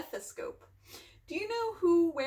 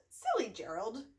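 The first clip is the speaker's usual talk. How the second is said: ordinary delivery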